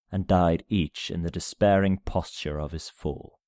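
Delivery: usual speaking voice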